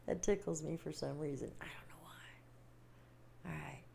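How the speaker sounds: whispered aside; half-whispered, amused. Real, not acted.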